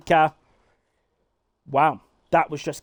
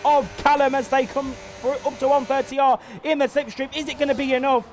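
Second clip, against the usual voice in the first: raised, driving play-by-play call; energetic motorsport commentator voice; live race commentary; 9 semitones higher than usual. Acted. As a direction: call the race lap by lap like a broadcaster.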